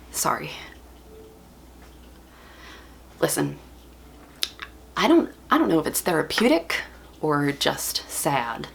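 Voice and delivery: low, quiet and halting; subdued apology. Acted. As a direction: pull back softly after an outburst, with long pauses. Subdued after the acted outburst.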